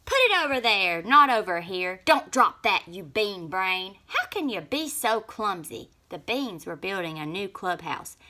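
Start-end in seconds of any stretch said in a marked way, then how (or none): 0.1-5.9 loud bossy shouting; yelling. A narrator's reading. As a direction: bark orders at the builders. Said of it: "Snap Bean was yelling at everyone".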